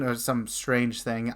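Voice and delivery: ordinary delivery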